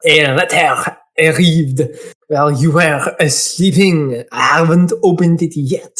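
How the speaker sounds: put-on slow French-accented delivery; unhurried French accent. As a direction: play the French assistant, measured. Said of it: "He's gonna be French".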